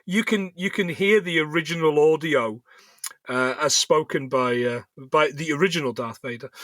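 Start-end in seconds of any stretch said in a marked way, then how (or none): none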